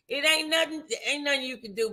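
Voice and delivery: ordinary talk, nothing marked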